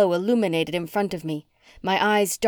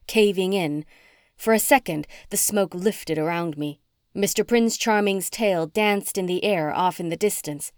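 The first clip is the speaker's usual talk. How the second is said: ordinary delivery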